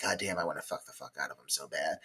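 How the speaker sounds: low, quiet, quick mutter; imagined private aside, low and hushed; retold lustful thought. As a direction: mutter it fast under your breath. Voicing smitten woman's private thought.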